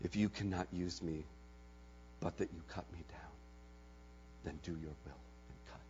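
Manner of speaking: whisper. Acted. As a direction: give in, barely above a breath. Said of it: "and there came a whisper".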